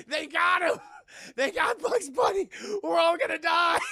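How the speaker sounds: high, shouted cartoon panic; hammed-up screaming hysteria; Porky Pig. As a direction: wail as if doom is certain. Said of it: "Porky Pig's like".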